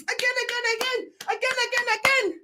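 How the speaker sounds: put-on high childish again again; mocking the club, high Teletubby chant; Teletubbies voice. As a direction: squeal it like a Teletubby. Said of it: "like Teletubbies".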